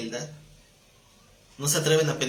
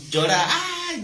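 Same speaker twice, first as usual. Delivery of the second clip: put-on a short high yelp, "¡ay!", like a child in pain; imitating his daughter crying from real pain, a sudden high "¡ay!"; pained cry imitation; 12 semitones higher, 4 dB louder, much slower than usual. Shown as a demonstration. Pastor imitating his daughter's cry of pain, high yelp. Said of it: "Cuando mi hija llora de dolor".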